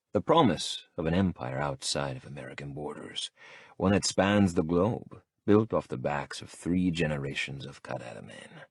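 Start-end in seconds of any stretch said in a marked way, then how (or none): none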